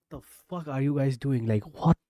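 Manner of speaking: loud disbelieving yell at the screen; loud frustrated outburst at the game; exasperated shout. Real, not acted. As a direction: really yell it, fed up.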